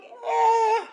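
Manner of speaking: high, squeaky, sheepish dodge laughing through it; sheepish squeak. Retold or imitated. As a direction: squeak it out like a caught kid.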